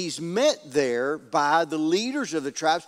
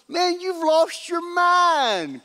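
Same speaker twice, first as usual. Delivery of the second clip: high, drawn-out indignant cry; hammed-up exasperated protest; outraged disbelief; 10 semitones higher, 3 dB louder, much slower than usual. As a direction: stretch it out in mock outrage. Acted outrage at torn coat.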